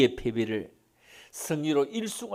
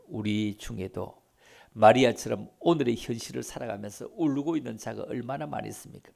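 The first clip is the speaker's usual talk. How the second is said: usual speaking voice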